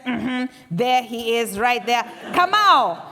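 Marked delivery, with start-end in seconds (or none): 2.3-3.0 loud call. Acted. Elder summons husband.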